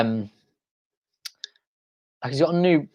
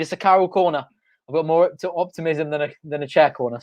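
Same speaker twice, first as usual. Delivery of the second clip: ordinary delivery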